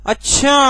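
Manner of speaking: "अच्छा" climbing high on a lengthened last vowel; rising अच्छा. Shown as a demonstration. Teacher showing tones within one word, rising. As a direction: lift the end of "अच्छा" upward. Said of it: "ये बोलने के ही तो तरीके".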